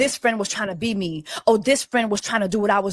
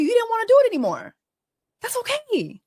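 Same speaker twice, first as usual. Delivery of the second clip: rising exasperation; 14 semitones higher than usual. Real, not acted. Venting to her co-host about a lazy friend, voice climbing high. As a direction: vent faster and higher until it tips over.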